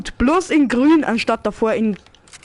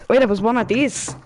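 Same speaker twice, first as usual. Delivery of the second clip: ordinary talk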